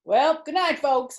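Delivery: high, loud cheerful sign-off; breezy farewell. Acted. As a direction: breeze out like nothing happened. Trying to sneak away.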